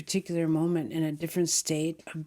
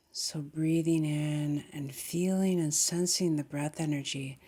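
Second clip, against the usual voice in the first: calm meditation guide, long pauses between phrases; guided meditation. Real, not acted. Guiding meditators to feel into the body, calm meditation voice. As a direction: ease them gently into breath and body, unhurried.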